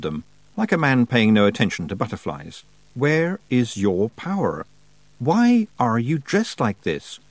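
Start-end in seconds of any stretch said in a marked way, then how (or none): 2.9-7.2 slow, deliberate demand, word by word; demanding Wintersmith. A narrator's reading. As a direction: demand it slowly, each word set apart. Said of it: "the Wintersmith demanded".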